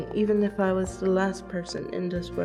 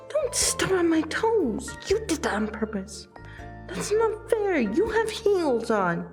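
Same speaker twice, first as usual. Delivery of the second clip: indignant protest; 8 semitones higher than usual. Acted. Dancer stepped on by friend in heels, indignant protest. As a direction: protest the hurt toes in a high pitch. Protest at having toes stomped.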